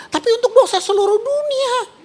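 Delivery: high, breaking with feeling; emotional climax. Real, not acted. Preacher to congregation, voice breaking with emotion. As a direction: let the voice crack as it soars.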